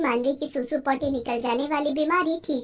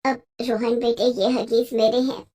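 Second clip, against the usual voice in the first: put-on lower, sheepish older woman confessing; ashamed mother-in-law; 3 semitones lower than usual. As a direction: admit the secret with head bowed. (शर्मिंदा सास की धीमी, नीची आवाज़ में कबूलनामा)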